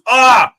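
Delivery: a loud, high mock scream of pain; imitated scream. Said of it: "this dude goes".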